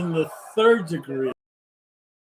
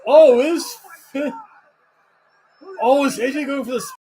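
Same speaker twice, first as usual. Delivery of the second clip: excited; 7 semitones higher, 3 dB louder than usual. Real, not acted. Reacting live to a run-in.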